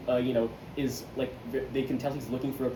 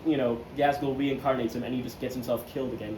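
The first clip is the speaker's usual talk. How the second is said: normal talking voice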